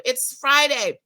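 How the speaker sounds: usual speaking voice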